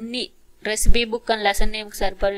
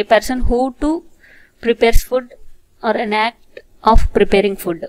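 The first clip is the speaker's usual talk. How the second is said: louder, slow word-by-word reading; slow loud repeat; 6 dB louder, much slower than usual. Shown as a demonstration. Teacher repeating the definition for students, slow and louder. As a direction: repeat it clearly for the class.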